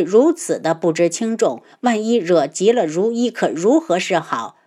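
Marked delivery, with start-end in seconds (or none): none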